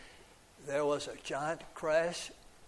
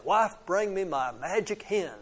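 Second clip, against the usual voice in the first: ordinary delivery, nothing marked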